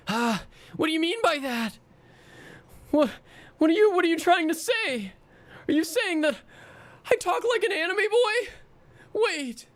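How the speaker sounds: put-on high, bright anime boy voice. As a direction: play a shy anime hero. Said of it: "see my face while I do that".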